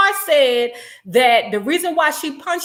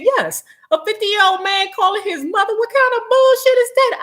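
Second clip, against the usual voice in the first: mocking disbelief; 8 semitones higher than usual. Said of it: "I said".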